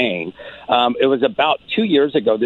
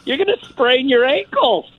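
mock-casual teasing; 11 semitones higher than usual. Comedian teasing the girl on the bridge, high mock-casual. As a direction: make the bridge sound ridiculous.

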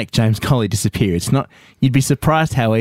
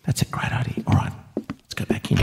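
whispering; 7 dB quieter than usual. Acted. Conspiratorial aside before going in.

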